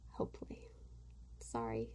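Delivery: breathy whispered repeat of hopefully, no voice. Shown as a demonstration. Vlogger whispering a hope to her viewers, voiceless.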